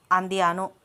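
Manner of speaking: usual speaking voice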